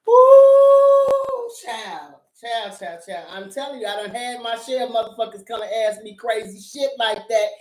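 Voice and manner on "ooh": a long, high, loud ooh. Real, not acted. Reacting to the show, a long high loud shocked ooh.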